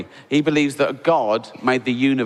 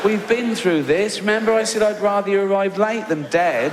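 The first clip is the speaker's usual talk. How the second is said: patient scolding; 6 semitones higher than usual. Retold or imitated. Boss to late employee Ted, a mild patronising scolding. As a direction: gently tell off a foolish colleague.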